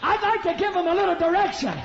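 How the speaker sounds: loud, high, ringing sermon shout; climbing Pentecostal shout over the music; full-force preaching. Acted. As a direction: preach it to the rafters.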